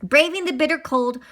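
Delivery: ordinary delivery, nothing marked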